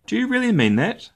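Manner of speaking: high, amazed little bear voice; surprised little cub. Acted. Little Bear amazed his daddy means it. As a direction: squeak it out in wide-eyed wonder. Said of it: "eyes opened wide in surprise".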